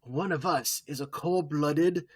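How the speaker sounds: slow, hammed-up French accent. [name] announcing the murderer, slow and French-accented. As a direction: drag it out like a mock French detective. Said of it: "in a French accent".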